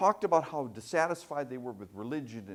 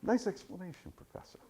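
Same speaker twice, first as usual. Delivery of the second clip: mock dismissive subject; 3 semitones lower, 6 dB quieter than usual. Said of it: "She's listening to me like this".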